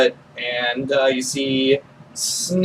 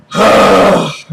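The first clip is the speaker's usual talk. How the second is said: loud, heavy sigh; weary sigh; 3 semitones higher, 6 dB louder than usual. Acted. Sherwood after one hell of a night, weary sigh. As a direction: let out a big acted sigh.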